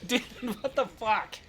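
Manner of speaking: very high, squeaky with laughter; real helpless laughter, high-pitched; laughing disbelief. Real, not acted. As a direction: say it while cracking up, pitch way up. Cracking up at absurd news.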